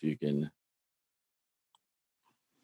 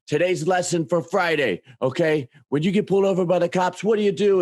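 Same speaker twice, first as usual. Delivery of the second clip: much higher, fast lecturing host; mock lecture; 9 semitones higher, faster than usual. Imitating the video host quizzing his buddy, higher. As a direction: quiz the viewer like a pushy teacher. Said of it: "they come up and they go".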